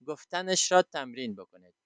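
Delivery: ordinary delivery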